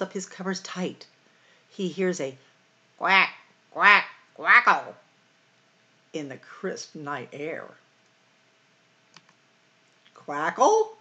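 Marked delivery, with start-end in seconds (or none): 3.0-4.9 high, loud quacking; duck quacks. Acted. Storyteller to kids, voicing the mysterious duck. 10.1-10.9 high, loud, puzzled quackle. Acted. Farmer Brown puzzled by the odd noise, surprised question.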